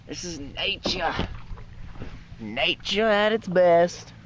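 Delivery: slow, hushed nature-documentary narrator; nature narration. Pretend. Vlogger asked to narrate, slow nature-documentary voice. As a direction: draw out each phrase like a wildlife film. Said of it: "is it not narrating?".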